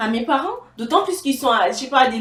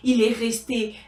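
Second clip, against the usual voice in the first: usual speaking voice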